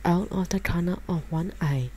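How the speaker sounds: ordinary delivery, nothing marked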